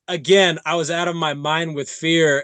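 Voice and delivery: normal talking voice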